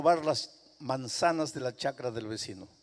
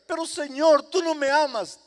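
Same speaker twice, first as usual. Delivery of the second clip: high, loud, hurt plea; loud, wounded cry to the Lord; pleading sinner; 11 semitones higher, 10 dB louder than usual. Acted. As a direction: accuse the Lord of not loving you.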